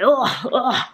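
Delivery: repeated straining grunts; effort grunt. Real, not acted. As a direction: grunt twice, pushing hard. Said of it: "Sorry, I keep grunting".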